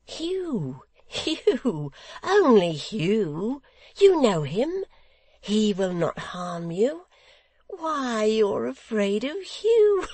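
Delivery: high, drawn-out, gleeful reassurance; slow, singing high voice of a merry innocent; Barnaby, eager simpleton. A narrator's reading.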